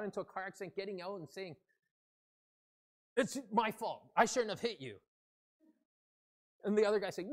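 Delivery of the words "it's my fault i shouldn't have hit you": higher, louder quarrelsome apology; mock-angry apology. Driver getting out after a car accident, angrily. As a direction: apologise as if arguing. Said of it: "Or even without the angry voice".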